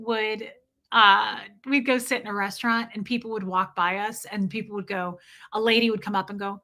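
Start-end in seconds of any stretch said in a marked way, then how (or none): none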